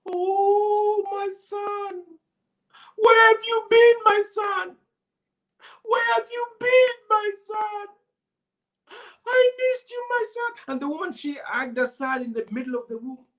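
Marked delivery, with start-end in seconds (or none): 0.0-10.5 high, loud joyful cries; calling out to a long-lost son; overjoyed mother. A narrator's reading. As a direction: ecstatic reunion, much higher. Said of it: "she said".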